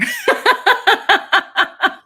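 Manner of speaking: loud, high real laugh; big laugh. Real, not acted. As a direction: let out a long happy laugh. Big laugh after "from everywhere.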